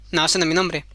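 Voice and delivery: low, smug refusal; the goblin rejecting the queen's guess. Acted. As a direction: answer low and unbothered.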